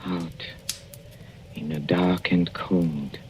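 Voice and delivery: soft, fading threat; fading menace. Acted. Dying husband threatening his wife, fading quiet menace. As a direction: let the threat sink to a breath.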